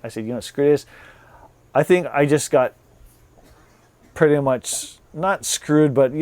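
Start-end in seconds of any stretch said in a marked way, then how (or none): none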